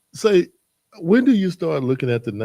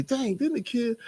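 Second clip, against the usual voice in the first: surprised; 13 semitones higher, 4 dB quieter than usual. Retold or imitated. Retold surprise, high pitch.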